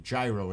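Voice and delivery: "gyro" with a j sound, long i; New York gyro. Shown as a demonstration. Asking about New York usage, "gyro" as JAI-roh. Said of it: "as I'm pronouncing it".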